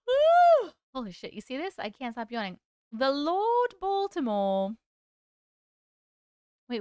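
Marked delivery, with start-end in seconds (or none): 0.1-0.7 loud high cheer; cheering woo. Real, not acted. Sudden cheer. 2.9-4.7 announcing title. Shown as a demonstration. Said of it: "dramatic title announcement".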